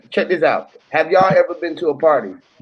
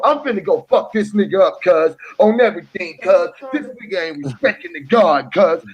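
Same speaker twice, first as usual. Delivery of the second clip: ordinary talk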